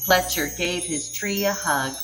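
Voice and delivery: ordinary delivery, nothing marked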